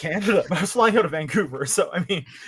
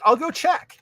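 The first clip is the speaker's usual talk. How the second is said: high flight attendant voice; 11 semitones higher than usual. Retold or imitated. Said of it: "she's like".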